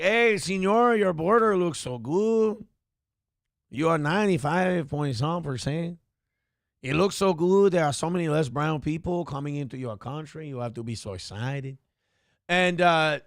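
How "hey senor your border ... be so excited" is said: playing the Salvadoran president, overly flattering. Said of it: "that's my impression of Gordy".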